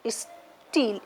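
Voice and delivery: "steel" said as is, pause, teel; split into syllables. Shown as a demonstration. Teacher showing students the hyphen, split into syllables. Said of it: "in this way you will put the hyphen".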